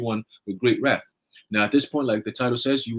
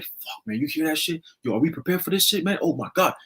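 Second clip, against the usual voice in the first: hushed and a little higher, scared of what's coming; frightened whisper; 4 semitones higher, 3 dB quieter than usual. Pretend. Man hearing troops coming, hushed scared whisper. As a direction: whisper it like you hear an army approaching.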